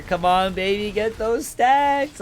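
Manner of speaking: high, pleading cheer, drawn out slowly; root hard for the player to get it; excited cheer. Real, not acted. Cheering on player's stack farming.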